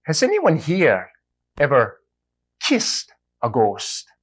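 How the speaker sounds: priest voice. Acted. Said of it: "Priest leans in".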